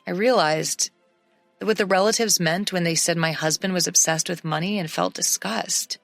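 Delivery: usual speaking voice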